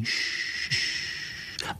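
long soft shushing "shhh"; rustling corn sound. A narrator's reading. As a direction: imitate rustling corn with a drawn-out hush. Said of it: "ta šumí". (šumění kukuřice)

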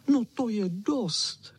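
high, slow whine; whining. Acted. The little dog whines as the others return. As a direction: whine it high, stretching the last word. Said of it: "kničel".

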